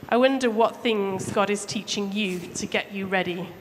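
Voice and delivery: ordinary delivery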